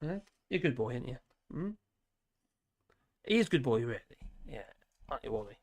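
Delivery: praising the dog. Real, not acted. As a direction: coo gently at the dog. Praising his dog Wally.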